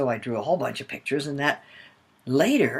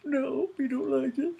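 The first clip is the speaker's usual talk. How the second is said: put-on high childlike chorus; kids answering; 10 semitones higher, 5 dB quieter than usual. Children answering their mother in a high, childish chorus. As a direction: sing it back like small children. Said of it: "And they all went".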